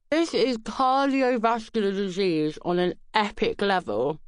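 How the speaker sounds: talking through a mouthful of icing; mouth full. Acted. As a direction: mouth full of cake, words round it. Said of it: "said through a mouthful of icing".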